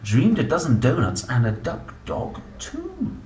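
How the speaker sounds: plain reading voice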